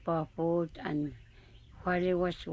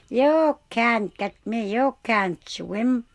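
mocking singsong tease, louder and slower; goose taunting; 5 semitones higher, 8 dB louder, slower than usual. A narrator's reading. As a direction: taunt the fox from the water.

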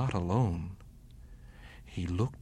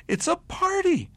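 high, loud bunny voice, thrilled; excited little bunny; 9 dB louder than usual. A narrator's reading. Bunny hearing the mice's party, high and thrilled. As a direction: squeak out the happy discovery.

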